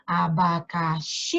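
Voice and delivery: low, slow Abacaxi with a long held XI; a-ba-ca-XIIII, stretching the last syllable; calling out the word. Shown as a demonstration. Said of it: "vamos chamar de novo".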